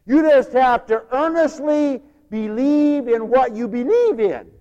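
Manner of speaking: loud, high, slow proclamation; emphatic preaching. Acted. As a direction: drive each word home from the pulpit. Mock-preaches sincerity at full force.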